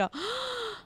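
soft, high gasp; amazed gasp. Real, not acted. Player sees Dirk win an accolade, amazed gasp.

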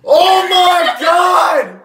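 high, loud, drawn-out shriek of delight; slow shocked yell at the mirror; excited shout. Real, not acted. [name] sees his pierced ear in mirror, shrieking.

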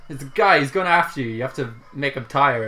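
normal talking voice

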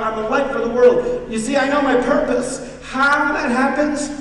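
loud, high pulpit preach; fervent sermon delivery; full-force preaching. Acted. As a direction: preach it out to the congregation.

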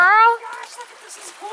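high excited squeal. Real, not acted. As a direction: squeal with delight at the sight.

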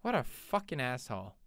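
low, soft, bitter grumble; quiet seething. Real, not acted. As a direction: mutter it under your breath. After the shouted version.

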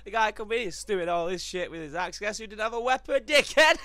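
high, loud frantic retelling; hyped after a fight; excited yell. Real, not acted. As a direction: shout it out through the adrenaline.

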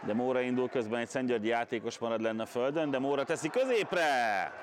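brisk live play-by-play; excited hungarian sports commentary. Acted. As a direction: call the attack as it happens.

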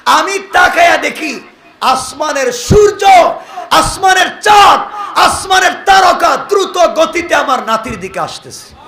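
high, loud, awed preaching shout; full-force preaching. Acted. Preacher retelling the grandfather's vision in an awed high shout. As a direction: cry out the wonder to the crowd. Sermon describing the sky at the birth.

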